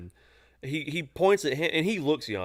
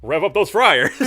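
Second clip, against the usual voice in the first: louder, higher demand for the fryers; mock aggressive order; 5 semitones higher, 9 dB louder than usual. Coworker retelling the customer's entrance, mock shout. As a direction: shout it like a guy barging in. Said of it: "that's essentially how he walked in".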